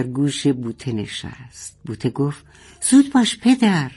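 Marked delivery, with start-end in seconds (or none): 2.9-3.9 put-on high, urgent young girl. Boteh urging her father to hurry, high and urgent. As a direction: hurry him along, voice pitched up.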